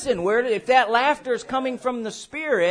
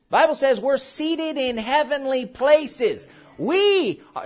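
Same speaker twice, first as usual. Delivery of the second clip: ordinary talk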